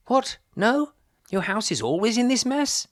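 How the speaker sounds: very high, loud startled questions; shocked disbelief. Acted. Vicar startled by the mother in her messy house. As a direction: gasp out both in disbelief.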